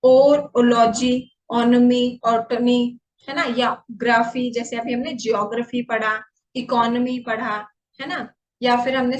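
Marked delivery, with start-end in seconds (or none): 0.6-3.0 "ओलॉजी" drawn out slowly and loud; teacher showing suffixes; stressed word endings. Shown as a demonstration. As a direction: slowly present each ending, "ओलॉजी", "ओनोमी", "ऑटोमी". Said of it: "जिनका एंड होता है".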